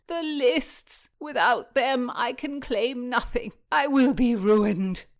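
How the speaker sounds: high, loud distraught wail; blubbering. A narrator's reading. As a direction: moan and beg as if ruined. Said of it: "begging and blubbering again".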